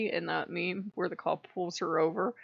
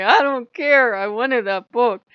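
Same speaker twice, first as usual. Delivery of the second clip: loud petulant outburst, higher; 5 semitones higher, 11 dB louder, slower than usual. Retold or imitated. Sulking at Loki over the feather, petulant. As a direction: stamp your foot like a sulking kid. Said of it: "And I'm like".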